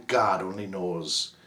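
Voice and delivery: plain reading voice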